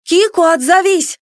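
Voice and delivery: high, loud, urgent call. A narrator's reading. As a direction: call out for an answer. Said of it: "позвала я". (зовёт громко и высоко)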